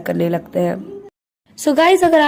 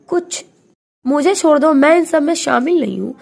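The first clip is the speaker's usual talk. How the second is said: higher, louder desperate plea; frantic begging; 7 semitones higher, 5 dB louder than usual. Acted. Husband pleading with captors to let him go, high and loud. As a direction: beg for your life, voice climbing high.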